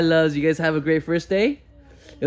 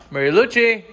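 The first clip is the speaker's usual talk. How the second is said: louder, higher name call; 5 semitones higher, 5 dB louder than usual. Real, not acted. Looking for Mary Lou, raised calling voice. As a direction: call out for someone across the house.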